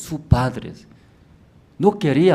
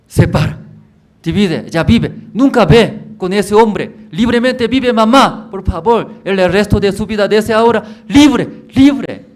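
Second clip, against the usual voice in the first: high, loud, urgent begging; urgent pleading; 10 semitones higher, 11 dB louder than usual. Acted. Son begging his mother to leave the man, high and urgent. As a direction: beg her to be free, pushing higher.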